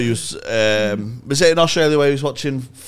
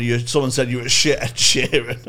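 usual speaking voice

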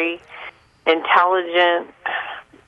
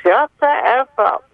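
mild mock yell, pitched higher; imitated mother's scream; 5 semitones higher than usual. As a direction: re-enact a mother yelling, without full volume. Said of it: "my mother was screaming from the other room".

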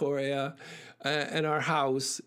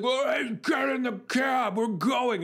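higher, raised mock yell; drunk man yelling; 8 semitones higher than usual. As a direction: bark at her to get in the cab. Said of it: "And he's like".

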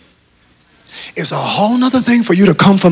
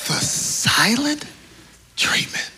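hushed; 6 semitones higher, 10 dB quieter, much slower than usual. Real, not acted. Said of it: "dramatic pause".

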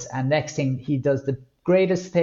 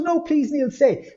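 high, pleading voice of the sister-in-law; begging sister-in-law; 13 semitones higher, slower than usual. Retold or imitated. Sister-in-law begging Neil not to leave, high pleading. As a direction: beg him to stay.

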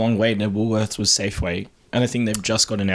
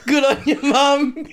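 put-on high, laughing kid's thank-you to mum; mock kid's cheer; 13 semitones higher, slower than usual. Friends joking about a sad school lunch, giggly high kid voice.

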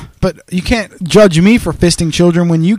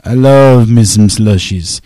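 drawn-out relish; 4 semitones lower, 8 dB louder, slower than usual. Pretend. Savoring slushie love, drawn out.

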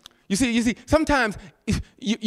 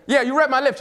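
ordinary delivery

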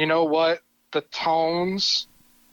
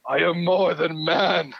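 Skeletor booming demon lord. Acted. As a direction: start dark and hushed, end bellowing like a god. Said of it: "I wanna give you the floor here to do the monologue".

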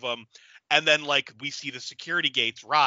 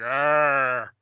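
monster grunt. Retold or imitated. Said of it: "Have him be like".

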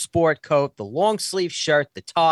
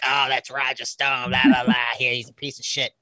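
lightly imitated sneering table gossip; mock snarling. Two women at next table bad-mouthing him, mock snarling. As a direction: mimic their snickering disdain. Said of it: "their snarling and snickering at the table".

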